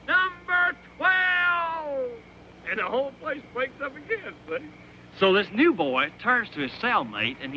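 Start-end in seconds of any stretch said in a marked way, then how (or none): 0.1-2.1 high, stretched-out holler; yelled out. Acted. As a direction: call it slowly down the hall. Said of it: "he yells out".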